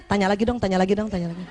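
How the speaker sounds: low, pleading repeated mutter; inner voice. Retold or imitated. Wife silently wishing her husband would ask, low pleading inner voice. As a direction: murmur a secret wish, over and over. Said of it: "Tapi dalam hatinya".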